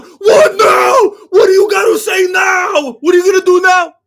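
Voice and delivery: loud, high shouted taunt into the mic; mock furious yell. Fighter taunting the crowd after the win, shouted. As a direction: bellow it like a fighter grabbing the mic. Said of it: "He's freaking out".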